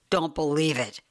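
ordinary delivery, nothing marked

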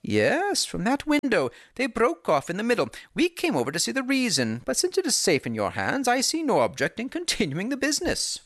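calm and knowing; Holmes, cool. A narrator's reading.

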